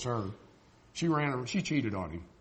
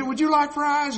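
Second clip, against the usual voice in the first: high, louder cheerful server question; cheery server; 11 semitones higher, 7 dB louder than usual. Retold or imitated. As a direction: chirp it like a fast-food worker. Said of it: "and they say".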